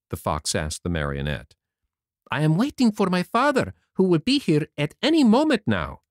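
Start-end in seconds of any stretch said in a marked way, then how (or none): 2.3-6.0 put-on higher, bright young puppet boy; boyish Pinocchio voice. A narrator's reading. To the fairy, Pinocchio speaks in a high boyish voice. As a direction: play the eager wooden boy, much higher.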